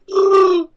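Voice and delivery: high howling Wookiee roar; Chewbacca roar. Pretend. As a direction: let out a loud Wookiee yowl. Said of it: "that was pretty good, honestly".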